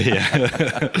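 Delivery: yeah through a real laugh. Real, not acted. Host amused by the guest's rueful wish, laughing.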